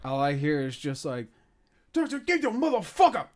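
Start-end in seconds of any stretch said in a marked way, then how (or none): 1.9-3.3 put-on much higher Cuban accent shout. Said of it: "in, like, a Cuban accent".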